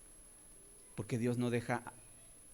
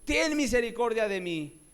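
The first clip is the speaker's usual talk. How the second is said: high, loud pleading shouts; calling out; 8 semitones higher, 6 dB louder than usual. Retold or imitated. Mother running after Jesus, loudly crying out for her daughter. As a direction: shout after him, begging. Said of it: "dando voces".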